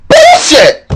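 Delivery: high, drawn-out mock holler; outraged shouting. Colonists reacting to the stamp tax, high outraged yell. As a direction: bellow it in hammed-up outrage.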